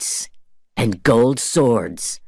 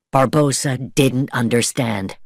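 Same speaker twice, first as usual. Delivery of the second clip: ordinary delivery, nothing marked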